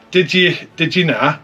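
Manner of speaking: half-hearted mock Geordie; attempted Geordie accent. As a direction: take a clumsy stab at Geordie. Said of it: "I can't do a Geordie accent".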